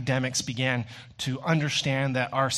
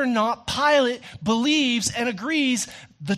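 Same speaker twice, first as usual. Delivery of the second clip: higher, louder, driving preacher's build; full-force preaching; 6 semitones higher, 4 dB louder than usual. Acted. Pastor pressing the congregation about Christ's gaze, fervent preaching. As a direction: preach it hard, hammering each point home.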